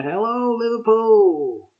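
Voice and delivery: high, loud, slow rock-star shout to the crowd; stage greeting. Retold or imitated. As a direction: hail the whole hall from the stage. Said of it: "And he's going".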